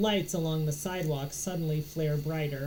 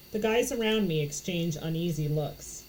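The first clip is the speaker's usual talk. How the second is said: ordinary delivery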